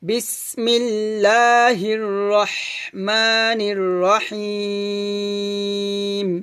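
slow, drawn-out melodic tajweed recitation; Quran recitation. Shown as a demonstration. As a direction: recite it slowly with a melodic chant. (ধীর সুরেলা কুরআন তিলাওয়াত)

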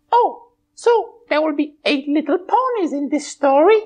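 high bright pony voice; a cheerful high puppet pony. Acted. Twilight puppet answering host, high pony voice.

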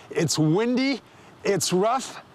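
ordinary talk, nothing marked

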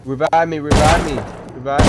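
urgent calling out. Real, not acted. Downed teammate begging revive.

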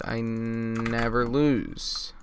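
very slow, stretched-out words; drawn-out insistence. Real, not acted. Drawn-out repeat of his boast.

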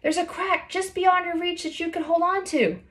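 high, carrying friend's voice; a friend calling up helpful advice. A narrator's reading. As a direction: guide her from below. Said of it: "Graceland shouted from below".

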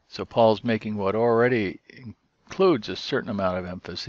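ordinary talk

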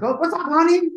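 high, loud mock gushing diva voice; high cheerful impression of the singer. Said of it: "she's like".

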